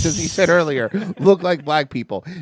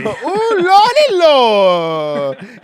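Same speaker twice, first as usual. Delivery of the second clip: much higher, louder and slow drawn-out lordy; mock minstrel voice; 6 semitones higher, 8 dB louder, much slower than usual. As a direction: stretch the lordy like a stage caricature.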